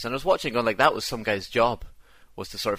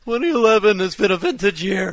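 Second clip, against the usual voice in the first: website voice; 12 semitones higher, 11 dB louder than usual. Retold or imitated. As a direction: read it in a shrill pompous website voice. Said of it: "don't do that voice".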